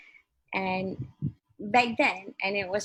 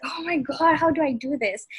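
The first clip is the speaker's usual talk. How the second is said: higher, louder mock panic; retold nervous panic; 6 semitones higher, 7 dB louder than usual. First-timer facing a screen test in Malay, nervously exclaiming. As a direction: act out a flustered, nervous freak-out. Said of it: "I remember being super nervous".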